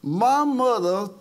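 preaching at full force. Acted. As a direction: preach it out to the congregation. Pastor preaching his testimony.

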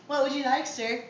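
high, bright service voice; flight attendant voice. Pretend. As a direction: ask like a polite flight attendant. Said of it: "I feel like a flight attendant".

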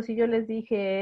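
usual speaking voice

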